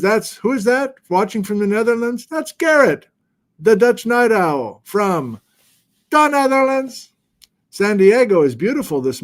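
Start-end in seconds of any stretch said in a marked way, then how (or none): 2.3-7.1 high, drawn-out announcer build-up; grand intro of a viewer. Real, not acted. Host on stream introducing viewer, in a hyped-up announcer voice. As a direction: announce the Dutch Night Owl like a ring MC.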